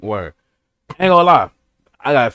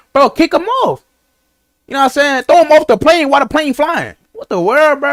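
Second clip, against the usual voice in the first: high, loud, disbelieving outburst; outraged; 10 semitones higher, 4 dB louder than usual. Real, not acted. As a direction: blurt it out in outrage. Outraged reaction to creep story.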